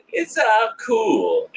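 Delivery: high, drawn-out reassurance; mock-naive singsong; imitating gullible Marty. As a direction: play the easily convinced dupe, slow and high. Mimics Marty being convinced.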